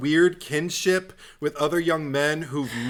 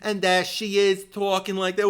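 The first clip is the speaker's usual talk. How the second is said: ordinary talk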